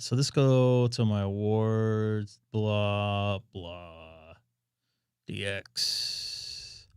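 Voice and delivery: usual speaking voice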